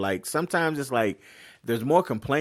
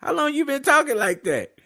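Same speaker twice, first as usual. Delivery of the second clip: high, mock-scandalized church-lady voice; scolding auntie. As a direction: play a shocked auntie scolding a boy. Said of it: "I don't want them looking like".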